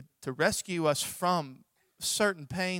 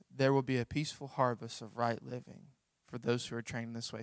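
normal talking voice

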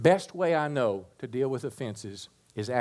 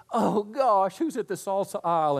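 high, loud, hammed-up alarm; mock dismay; 8 semitones higher, 4 dB louder than usual. Shopper spotting someone to avoid in the grocery aisle, mock dismay. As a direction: blurt it out as if caught off guard. Acts out dreading someone in store.

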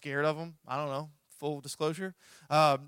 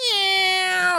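sudden high, loud yell at diving birds; battle yell; 11 dB louder than usual. Retold or imitated. Preacher yelling at swooping birds, loud high yell. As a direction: let out a wild, high war cry.

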